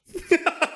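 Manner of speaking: a short, high, bitter laugh. A narrator's reading. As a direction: laugh once with bitter irony. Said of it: "There was a bitter laugh there".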